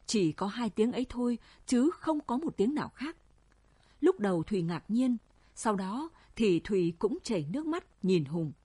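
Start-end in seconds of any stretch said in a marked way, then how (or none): none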